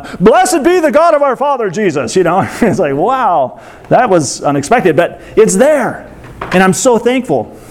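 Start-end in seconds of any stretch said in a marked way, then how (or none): 0.2-2.2 higher-pitched mock outburst of praise; sudden burst of praise. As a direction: imitate Paul breaking abruptly into exalted praise. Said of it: "like, burst out of nowhere".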